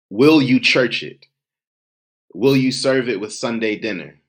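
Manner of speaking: rising, insistent poetic litany, pitch climbing to the end; spoken word poem. Acted. Poet demanding the audience say his name, building litany. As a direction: hammer every "Will you" like a call.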